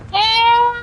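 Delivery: high, pleading cry of help; calling for help. Pretend. Downed streamer calls teammates for help, high pleading cries.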